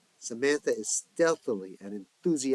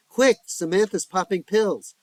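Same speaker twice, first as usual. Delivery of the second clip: urgent, higher and louder alarm; excited alarm; 9 semitones higher, 11 dB louder than usual. A narrator's reading. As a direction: burst in with the news, excited. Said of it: "mixed, excited, and controlled".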